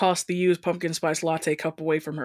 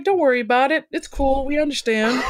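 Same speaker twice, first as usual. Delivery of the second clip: high, easygoing character voice; high-pitched, casual reassurance; reassuring goth girl; 8 semitones higher, 4 dB louder than usual. Acted. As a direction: brush it off in a lifted voice. Voices goth girl soothing Melissa.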